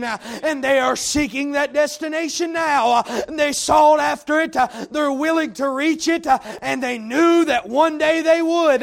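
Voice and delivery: rising, rhythmic pulpit cadence with punched words; preaching at full force. Acted. Preacher exhorting the congregation, full-force preaching. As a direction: preach it hard to the room.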